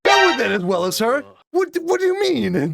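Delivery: high-pitched disbelief; incredulous. Real, not acted. Host reacts to praise of her coping, incredulous. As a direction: throw the claim back as a baffled question.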